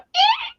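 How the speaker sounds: very high squeak like a squeaky toy; squeaky toy cat noise. Retold or imitated. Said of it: "she's like a squeaky toy".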